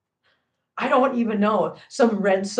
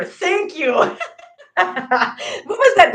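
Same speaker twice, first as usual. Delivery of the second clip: laughing; 8 semitones higher, 6 dB louder, much slower than usual. Real, not acted. Laughing at Ginger's pantsuit joke.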